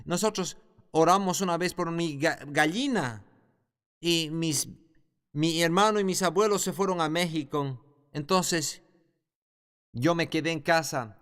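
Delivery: ordinary delivery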